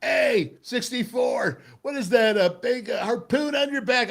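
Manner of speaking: high-pitched mock yell from the stands; imitated heckler. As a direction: holler a taunt like a heckling fan. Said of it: "And then I hear this".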